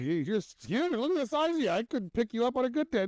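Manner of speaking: put-on high teasing voice of a young guy; mock teasing guy. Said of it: "He's like".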